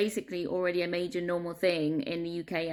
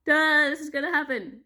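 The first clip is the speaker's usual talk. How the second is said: scornful "duh"; 7 semitones higher, 5 dB louder than usual. Retold or imitated. Retells own scornful obvious prediction.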